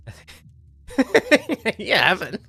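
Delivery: high, loud, slow words through laughter; fail to hold the laugh in; breaking up laughing. Real, not acted. Streamer failing to settle down, bursting with laughter.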